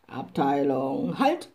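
captain commanding his riflemen to halt; military command. A narrator's reading. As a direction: bark it like a drill captain. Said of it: "kommandierte der Schützenhauptmann".

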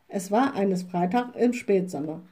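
ordinary delivery, nothing marked

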